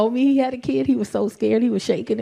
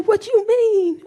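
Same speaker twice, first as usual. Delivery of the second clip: innocent surprise; 8 semitones higher, slower than usual. Retold or imitated. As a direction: play dumb like the son. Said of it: "He said".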